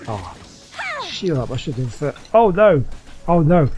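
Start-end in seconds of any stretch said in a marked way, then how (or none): 2.4-3.7 loud groan of dismay; loud real dismay; dismayed cry. Real, not acted. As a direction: cry out as the fight goes wrong. Boss fight going badly in rain.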